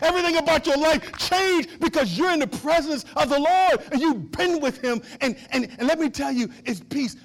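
loud, high, driving sermon delivery; full-force preaching. Real, not acted. Preacher telling church God's presence changes them, preaching hard. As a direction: build phrase on phrase with rising fervor.

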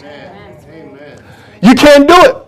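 usual speaking voice

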